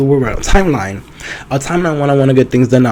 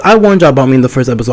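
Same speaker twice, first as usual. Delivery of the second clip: ordinary talk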